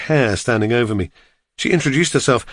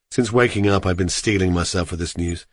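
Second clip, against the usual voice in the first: plain reading voice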